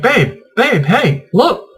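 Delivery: high, eager call to get her attention; excited calling out. Retold or imitated. Husband spotting a YouTuber, calling his wife excitedly. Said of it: "And I was like".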